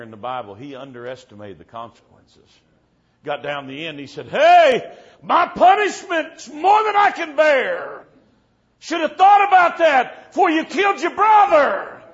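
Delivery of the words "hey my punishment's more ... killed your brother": shouted high as Cain, slowing on the lament; preacher hollering Cain's words at the congregation; Cain's anguished outcry. Retold or imitated. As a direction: cry out as Cain would in the Bible. Cain's words retold at the end.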